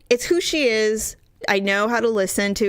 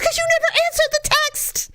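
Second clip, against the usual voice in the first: very high, fast mock screech; imitated irate mother; faster than usual. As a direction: screech the accusation like an exasperated mother. Re-enacting mother in the fight.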